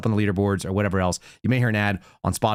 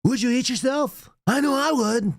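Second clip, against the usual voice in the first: cartoon Harry Carey; 11 semitones higher than usual. Host imitating Harry Carey as a cartoon announcer. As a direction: do a goofy drunken old ballpark announcer. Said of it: "like cartoon Harry Carey".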